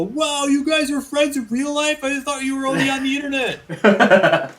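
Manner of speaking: high, slow, starstruck fan voice; wide-eyed fan gasping at the news; amazed fan. Retold or imitated. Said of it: "people just go".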